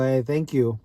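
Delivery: ordinary talk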